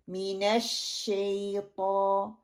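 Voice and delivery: मिनश शैतान slowly with a full "sh" and firm stress; full shin. Shown as a demonstration. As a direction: recite मिनश शैतान giving the "sh" its full weight. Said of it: "शीन की मुकम्मल अदायगी".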